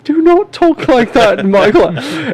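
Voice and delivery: high, slow mock British mimicry of the teacher; mocking British accent. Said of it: "everybody was like".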